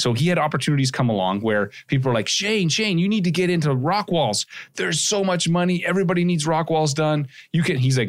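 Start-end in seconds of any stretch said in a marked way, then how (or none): 2.3-7.8 high-pitched, excited mock pleading; eager urging. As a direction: pitch it up like someone pushing a hot opportunity. People urging Shane, acted out.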